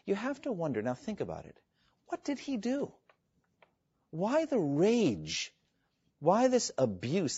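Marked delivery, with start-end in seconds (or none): 2.1-7.4 pleading questions. Real, not acted. Preacher pleads rhetorical questions.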